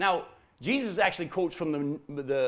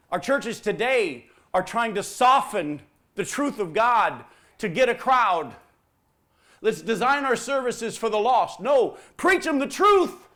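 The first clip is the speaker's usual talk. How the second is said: loud, slow, punched pulpit delivery; full-force preaching; 8 semitones higher, 10 dB louder than usual. Acted. Preacher rebuking churches that soften truth, building to a shout. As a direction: build to a high, booming command.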